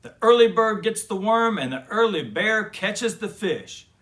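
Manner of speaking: loud and high, jolly; cheerful booming proverb; hearty grandpa call. A narrator's reading. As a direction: rouse sleepy kids with gusto. Gramps rousing sleepy cubs.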